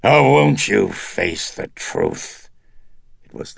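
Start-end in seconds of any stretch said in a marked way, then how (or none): 0.0-2.5 seething. Acted. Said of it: "the demon, seething".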